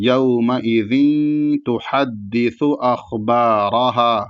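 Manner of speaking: slow chant, أَخْبَارَهَا ending on a held alif; Quran recitation. Shown as a demonstration. Teacher modelling the verse, slow chant. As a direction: recite slowly, hold the last ها. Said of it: "اس طریقے سے".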